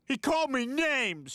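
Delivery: high, drawn-out whine; childish whine. Acted. Husband excuses himself to scolding wife, childish whine.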